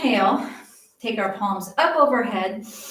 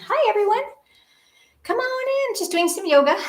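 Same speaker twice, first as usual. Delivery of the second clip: bright greeting; 10 semitones higher, faster than usual. Real, not acted. Greeting viewers joining live.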